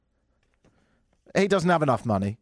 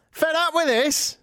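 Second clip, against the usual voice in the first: mock outrage; 13 semitones higher, slower than usual. Mock outrage at Apple's plan.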